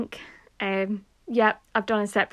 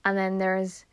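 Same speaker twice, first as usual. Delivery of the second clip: normal talking voice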